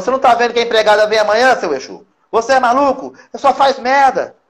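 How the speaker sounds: hammed-up exasperated wife; mock scolding wife. Wife scolding husband about the tidy house, mock exasperated.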